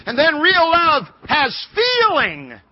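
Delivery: high, loud, drawn-out proclamation; slow, ringing preacher's cry; full-force preaching. Real, not acted. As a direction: stretch out the point in a pulpit shout.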